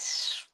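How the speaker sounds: soft lisped Shh. Shown as a demonstration. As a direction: hiss the Shh through braces. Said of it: "That's my lisp".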